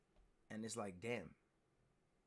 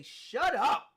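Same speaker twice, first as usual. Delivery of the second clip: sharp shout, much higher and louder; telling it to shut up; 13 semitones higher, 14 dB louder than usual. Retold or imitated. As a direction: shout down the doubting voice. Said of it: "You gotta say".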